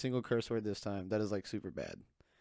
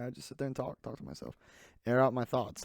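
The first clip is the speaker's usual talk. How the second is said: ordinary talk, nothing marked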